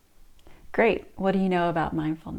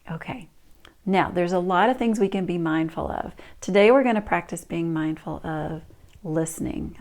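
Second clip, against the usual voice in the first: ordinary talk, nothing marked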